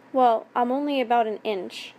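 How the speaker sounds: ordinary delivery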